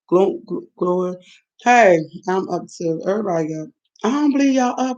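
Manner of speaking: ordinary talk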